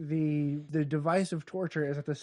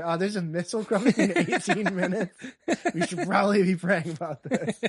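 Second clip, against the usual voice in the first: raised, laughing mock sermon; mock preacher; 4 semitones higher, 5 dB louder than usual. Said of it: "I'm preaching".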